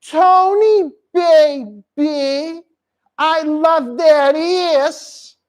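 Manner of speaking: high, slow seductress impression; Annie. Host as Annie seducing Tony, high slow impression. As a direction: put on a drawn-out, high come-on voice. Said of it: "She kinda talked like this".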